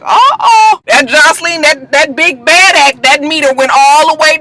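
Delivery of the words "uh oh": high, loud mock alarm. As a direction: yelp it like trouble's coming. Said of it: "I was just like".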